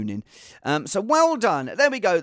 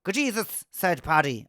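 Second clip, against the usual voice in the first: hammed-up Irish accent. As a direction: put on a stagey Irish accent. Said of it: "quite an offensive accent there".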